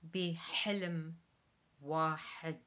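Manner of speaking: loud model with throaty H; Arabic phrase, throat H. Shown as a demonstration. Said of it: "So one more time".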